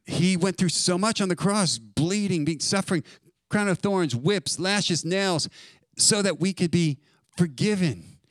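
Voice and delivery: fervent sermon climax; preaching. Real, not acted. Preaching at full force.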